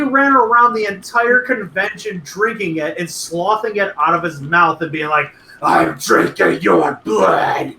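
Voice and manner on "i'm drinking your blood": slow, eerie ghost moan; ghostly voice. Acted. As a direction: drag it out like a haunting spirit. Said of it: "That sounded like a ghost".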